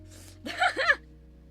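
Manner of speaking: squealing giggle; shrill laugh; high squealing laugh. Real, not acted.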